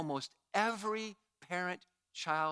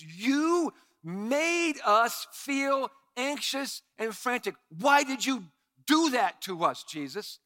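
slow, higher, louder, blaming her son; mock frantic mother; 5 semitones higher, 5 dB louder, slower than usual. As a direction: drag out the accusation like a hurt mother. Voicing Mary's frantic complaint.

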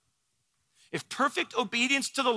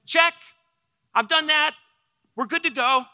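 lightly acted confidence, a little louder and higher; breezy self-assurance; 5 semitones higher, 5 dB louder than usual. Preacher voicing the rich young ruler answering Jesus, breezily confident. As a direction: toss it off as a quick, assured checklist answer.

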